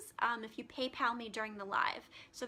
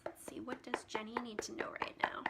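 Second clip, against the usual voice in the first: hushed whisper to herself while pulling a card; whispered musing; 6 dB quieter than usual. Real, not acted. Reader pulling a tarot card for Jenny, whispering to herself. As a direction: breathe it out as a private question.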